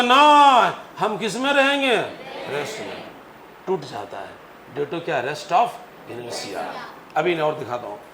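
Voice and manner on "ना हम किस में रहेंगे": voiced dust. Dust voiced in teacher's story.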